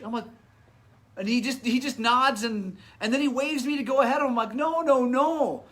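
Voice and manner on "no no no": retold protest, pitched high and loud; insistent refusal. Racer refusing to go ahead of his rescuer, emphatically insistent. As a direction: wave the offer away with loud, high noes.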